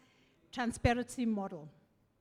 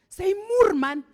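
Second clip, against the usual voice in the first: high, loud mock outburst; angry retort; 8 semitones higher, 11 dB louder, slower than usual. Minister rejects the premier's call, angry shout. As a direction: imitate an irate boss dismissing a caller.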